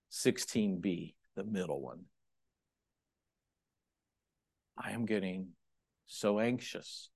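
soft, intimate spoken prayer; demo prayer. Shown as a demonstration. Preacher demonstrating his prayer to God, soft intimate. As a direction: pray quietly and honestly. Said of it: "the way it goes to death is this way".

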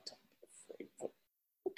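whispered, scoffing "Don't be afraid"; mocking whisper. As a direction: sneer it under your breath. Crowd mocking Jesus's words.

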